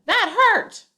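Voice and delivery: "That hurt" shouted high and loud after a plain "Tom said"; right version: "That hurt" shouted; yelling the quote. Shown as a demonstration. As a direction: keep the tag plain, then yell the hurt.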